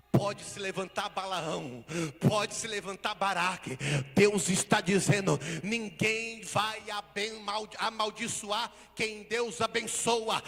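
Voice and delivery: loud, slow, sung-out preaching; preaching at full force. Real, not acted. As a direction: proclaim blessing over the church.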